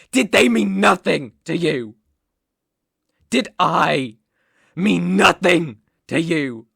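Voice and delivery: loud, emphatic demand; hurt accusation. Acted. Captor confronts runaway over shared memories, loud and hurt. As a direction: hurl it out as a wounded accusation.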